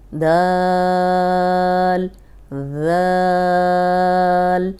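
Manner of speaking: each letter name held very long and loud; drawn-out letter names. Shown as a demonstration. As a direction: stretch every letter name out in a long chant. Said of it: "دوبارہ سے پڑھ لیتے ہیں". (حرف کو بہت لمبا کھینچ کر پڑھنا)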